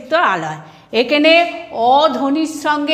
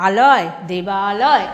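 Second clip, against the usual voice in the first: slow demo; 6 semitones lower, slower than usual. Shown as a demonstration. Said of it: "দেব যুক্ত".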